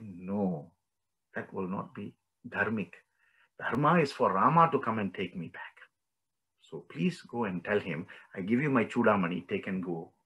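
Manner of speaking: soft, measured captive's reply; hushed, composed plea; gentle refusal. Retold or imitated. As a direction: decline quietly, with calm dignity. Voices Sita refusing, entrusting her token.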